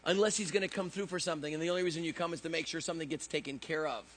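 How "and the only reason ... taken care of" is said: ordinary talk, nothing marked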